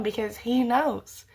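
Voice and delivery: ordinary talk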